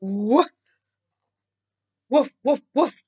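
short imitated woofs; dog barking. A narrator's reading. Storyteller voicing the approaching dog, barking. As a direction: bark it out in separate bursts. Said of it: "they didn't hear a distant".

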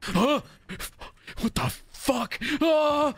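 alarmed. Real, not acted. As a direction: yelp in alarm. Surrounded by skeletons.

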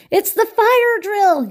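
high, loud alarmed yell, drawn out slowly. A narrator's reading. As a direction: shout it out as if the alarm just rang. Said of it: "yelled Cockroach".

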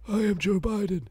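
light mock Biden; Joe Biden impression. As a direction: put on a doddery old president voice. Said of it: "He's like".

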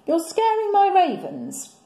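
high, loud fussy complaint; upset Beefeater. Acted. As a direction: fuss at the lion, put out. Costumed man complains.

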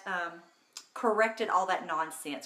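ordinary talk

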